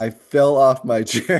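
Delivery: laughing as he tells it; breaking up mid-sentence. Real, not acted. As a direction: still laughing at the memory. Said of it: "I just started laughing".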